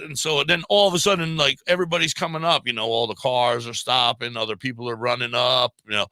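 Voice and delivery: ordinary delivery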